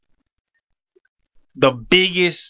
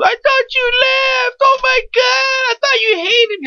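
mock crying girl; slower than usual. Imitating the crying girl he thought had left in a high wail. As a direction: ham up a sobbing woman shouting through tears. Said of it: "She goes / She's standing there crying".

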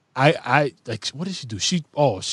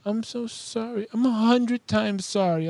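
put-on much higher, softer, slow childlike pleading; little daughter apologizing; 10 semitones higher, 7 dB quieter, slower than usual. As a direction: plead sweetly like a sorry little girl. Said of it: "And she's like".

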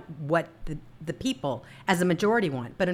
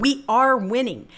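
excited; 7 semitones higher, 6 dB louder, slower than usual. Real, not acted. Excited rally cry repeated.

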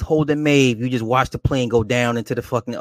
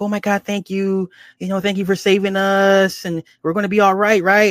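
high, relieved mock thanks; hammed-up high-voiced gratitude; imitated grateful passengers; 8 semitones higher than usual. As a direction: play the hopeful crowd, pitched well up.

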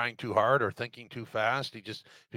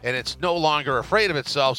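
normal talking voice

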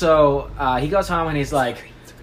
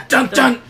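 loud, higher two-note "dun, dun"; dramatic sting; 9 semitones higher, 6 dB louder than usual. Acted. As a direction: punch out a suspense sting. Dramatic sting after the train.

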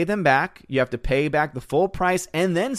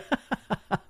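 higher, soft chuckle; laughter; 10 semitones higher, 6 dB quieter than usual. Real, not acted. Streamer amused by a viewer's refusal, giggling. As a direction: giggle at a comment.

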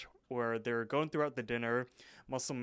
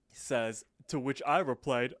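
ordinary talk, nothing marked

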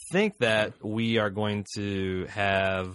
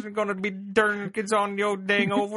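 Cartman; 11 semitones higher, 4 dB louder than usual. Acted. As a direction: garble it loudly in a squawking Southern twang. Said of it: "Cartman accent where he did the".

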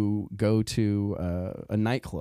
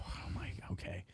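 unvoiced resigned whisper, barely audible; mortified whisper; 4 semitones lower, 16 dB quieter than usual. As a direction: breathe it out, beaten and embarrassed. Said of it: "see, I am mortified".

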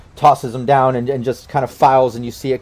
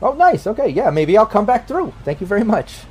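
higher-pitched, agreeable official; pleased official accepting an invite; Rainey, pleased official; 7 semitones higher than usual. Acted. Official accepts the grand opening invite, pleased and higher-pitched. Game master voices a character.

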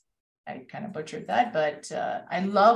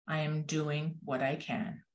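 quiet resolve; 2 semitones lower, 9 dB quieter than usual. A narrator's reading. Hummingbird answers the other animals, quiet storybook resolve.

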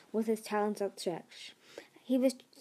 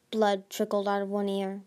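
plain reading voice

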